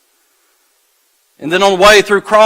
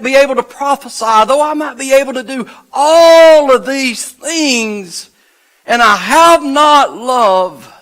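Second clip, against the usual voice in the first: building, higher-pitched preaching that stretches out at the end; impassioned preaching; 6 semitones higher than usual. Real, not acted. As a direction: pile up each clause with rising fervour, then draw it out. Preacher piles up scripture, building.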